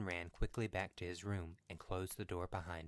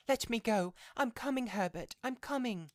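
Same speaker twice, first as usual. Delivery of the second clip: very high, loud put-on cries; frantic old woman calling; 8 dB louder than usual. A narrator's reading. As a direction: call out urgently, pitched way up. Struggling mother calls to her son.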